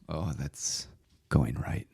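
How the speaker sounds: soft, halting commentary on a shot; hushed play-by-play, watching the ball drift; golf announcer. Pretend. Mock commentary on a wayward shot, hushed golf announcer. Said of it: "Could you say something golf announcer-like".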